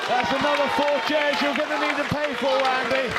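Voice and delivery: loud teasing. Real, not acted. Teasing partner over crowd noise.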